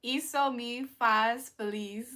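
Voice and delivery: slow, careful isso me faz feliz; careful Portuguese. Shown as a demonstration. Streamer trying Portuguese for chat, slow and careful. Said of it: "I said it does make me happy".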